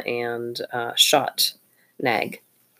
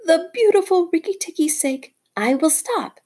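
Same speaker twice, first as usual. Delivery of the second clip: fawning little bird. Acted. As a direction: coo like a bird praising its hero. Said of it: "said Darzee".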